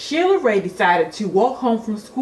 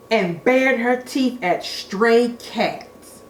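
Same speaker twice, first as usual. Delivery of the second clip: usual speaking voice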